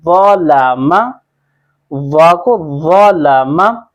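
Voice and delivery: व लामा slowly, rounded thick "vaw", light "laa-ma"; heavy व, then thin लामा; heavy first letter. Shown as a demonstration. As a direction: stretch व लामा with a full-mouthed first syllable. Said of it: "वाव को मोटा पढ़ा".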